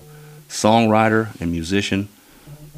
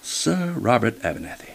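mildly put-on English accent; 3 dB quieter than usual. Shown as a demonstration. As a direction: demonstrate a modest mock English announcement. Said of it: "in your best English voice".

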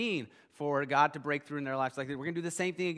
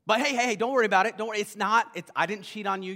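louder, higher pleading denial; acted frantic reassurance; 5 semitones higher, 5 dB louder, faster than usual. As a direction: act out a hurried plea to be believed. Acted Mary hurriedly denying cheating.

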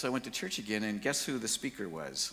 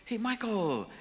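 excited greeting; 8 dB louder than usual. Retold or imitated. Greeter exposes him at door.

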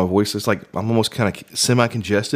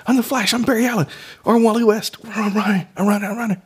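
high-pitched superhero excitement; super fast mode; 13 semitones higher than usual. Pretend. Man acting his mind racing like the Flash, high and hyper. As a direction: pretend to race off, voice shooting way up. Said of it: "super mode of the fast".

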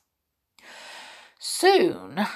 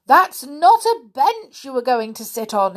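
loud booming elephant warning; bellowing; 5 semitones higher, 7 dB louder than usual. Acted. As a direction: bellow it across the jungle. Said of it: "he bellowed".

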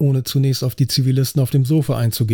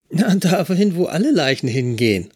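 higher, perky voice of the pierced trainee; cheeky young officer; 4 semitones higher than usual. A narrator's reading. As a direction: play the cocky trainee introducing herself. Trainee mocks the detective.

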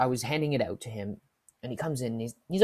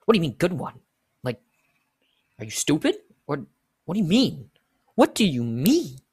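louder, indignant questioning, building; exasperated demand; 3 semitones higher, 7 dB louder than usual. Acted. Cashier questioning coffee customer's joke, exasperated.

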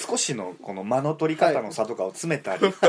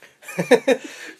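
stifled laugh; 11 semitones higher, 6 dB louder than usual. Real, not acted. 音出しちゃいけないと笑う.

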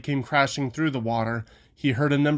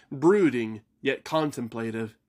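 ordinary delivery

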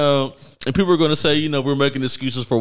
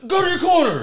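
hammed-up referee yelling go to your corner; mock ref shouting count; 14 semitones higher than usual. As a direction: holler the count like a panicked ref.

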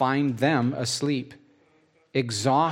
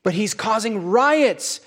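louder and higher, building insistence; insistent; 9 semitones higher, 4 dB louder than usual. Acted. Priests insisting to Pilate that he causes riots, louder and louder. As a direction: press the accusation harder and louder. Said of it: "Then they became insistent".

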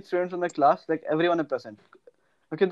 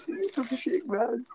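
quiet higher begging; soft pleading; 5 semitones higher, 10 dB quieter than usual. Real, not acted. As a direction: beg softly. Pleading to delete.

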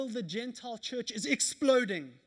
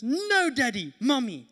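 imitating toddler refusal; 3 semitones higher, 9 dB louder than usual. Toddler pushing dad away.

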